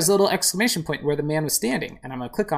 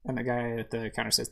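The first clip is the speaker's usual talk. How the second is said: usual speaking voice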